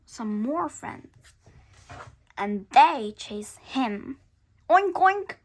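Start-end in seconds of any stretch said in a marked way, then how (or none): 4.7-5.3 loud, high oinking pig; loud pig noise. Acted. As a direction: oink like a pig. Animals chase dog.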